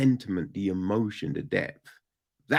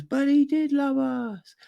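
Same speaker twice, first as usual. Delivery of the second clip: high voiced line; quoting a character; 12 semitones higher than usual. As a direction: lightly play the pleading son.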